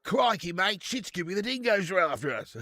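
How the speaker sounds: high, hammed-up Australian alarm; mock panic in a broad Aussie accent; Aussie impression. Streamer warning a mate of dingoes, exaggerated Australian accent. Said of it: "Do an Aussie impression?".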